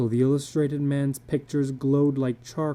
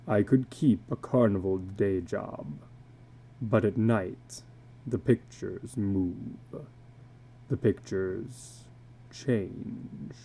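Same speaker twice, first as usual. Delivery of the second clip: lower, slowing to a hushed, breathy end; illustrated man, ominous; 4 semitones lower, 4 dB quieter, slower than usual. Acted. Tattooed man warning a stranger, sinking to a hush. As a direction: let the voice sink as the pictures move.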